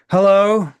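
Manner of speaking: loud, high-pitched exasperated phone pickup. Acted. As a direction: answer the phone loudly, fed up. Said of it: "this kind of exasperated voice".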